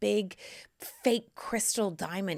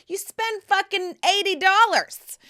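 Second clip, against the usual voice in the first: high, louder punchline; 11 semitones higher, 8 dB louder than usual. Acted. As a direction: throw the number up high and loud. Punchline after good meal.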